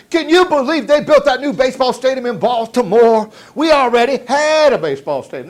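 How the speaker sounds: loud, high mock whining; whining complainer. Stranger griping about the new Baltimore stadium, loud mock whine. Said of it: "and they're whining".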